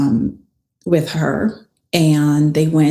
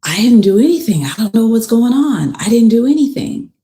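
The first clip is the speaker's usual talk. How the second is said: higher, hurried protest of innocence; retold agitated denial; 5 semitones higher, faster than usual. Blaze answering Keontae's accusation, retold as agitated denial. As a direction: imitate the accused rushing out a defensive denial. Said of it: "Blaze said".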